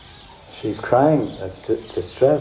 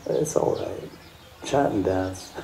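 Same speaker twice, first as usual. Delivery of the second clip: soft comfort; 3 semitones lower, 8 dB quieter than usual. Acted. Mahaprabhu comforting crying devotee.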